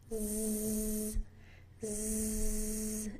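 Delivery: long voiced buzzing z; isolated z buzz, voice on; buzzing z sound. Shown as a demonstration. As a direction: hold a steady buzzing z on its own. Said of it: "while keeping your voice box turned on".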